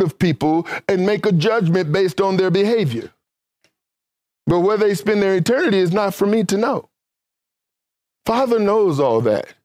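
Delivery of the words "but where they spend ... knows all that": ordinary delivery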